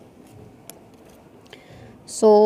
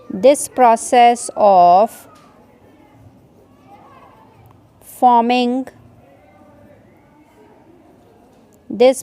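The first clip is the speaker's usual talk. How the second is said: very slow, phrase by phrase, with long pauses; slow dictation; much slower than usual. Shown as a demonstration. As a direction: dictate each chunk so students can write it down. Teacher dictates notes word by word.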